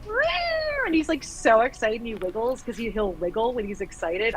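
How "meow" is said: very high mimicked droid chirp; imitated droid squeal. Fan mimicking BB-8's happy reply, imitated high squeal. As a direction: squeal like an excited little droid.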